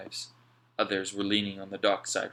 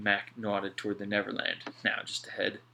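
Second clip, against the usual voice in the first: ordinary delivery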